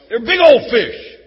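loud, slowed brag about the size; big fish boast. Shown as a demonstration. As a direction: sell the fish story big. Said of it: "It's a fish story".

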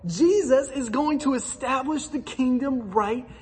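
picture Peter thrilled the moment has come; breathless, rising hype as Peter; imagined Peter's excitement. Retold or imitated. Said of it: "I wonder if he's thinking".

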